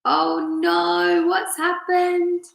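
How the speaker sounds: high, slow mock alarm; drawn-out oh no; mock dismay. Real, not acted. Reader reacts to stream.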